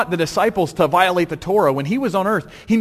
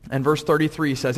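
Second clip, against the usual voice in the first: ordinary delivery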